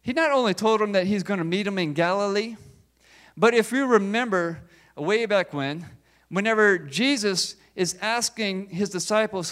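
ordinary delivery

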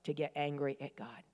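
hushed divine reprimand to an angry woman; hushed Holy Spirit rebuke. Retold or imitated. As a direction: whisper it like a holy rebuke from above. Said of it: "and he said".

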